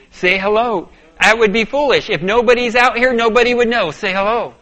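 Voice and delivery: loud and high, arguing with himself; heated inner argument. Retold or imitated. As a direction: push back hard against the nagging voice. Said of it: "an argument with a voice".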